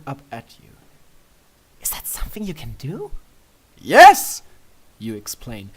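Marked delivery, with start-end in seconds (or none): 1.8-3.1 high breathy princess whisper. A narrator's reading. Luna hushed and curious about the hot tub, whispering. 3.8-4.4 huge high yelp of excitement; excited shout. A narrator's reading. You answering Luna about the tub, shouting with excitement. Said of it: "shaking with excitement".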